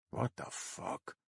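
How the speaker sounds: breathless, voiceless hiss of disbelief; shocked whisper. Acted. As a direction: whisper it under the breath in a courtroom. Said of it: "Soch whispers".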